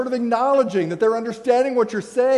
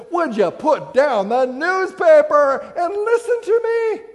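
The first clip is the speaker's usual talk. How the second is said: higher, louder, slow exasperated plea; mock-frustrated wife demanding to be heard; wife pleading at the breakfast table, higher and louder; 8 semitones higher, 6 dB louder, slower than usual. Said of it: "gets exasperated and says".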